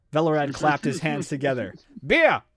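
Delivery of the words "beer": loud, high call. A narrator's reading. Velorad calling for beer for his guest, loud call. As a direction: call it out like an order across the room. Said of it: "he called".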